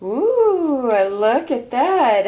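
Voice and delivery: delighted high ooh, slow look; thrilled at what the cards show; surprised ooh. Real, not acted. Spotting a striking card spread.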